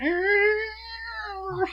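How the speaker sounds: very high, drawn-out screech; piercing mock monster cry; imitated chalkboard screech. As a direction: squeal like nails down a chalkboard. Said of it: "scratching on chalkboard".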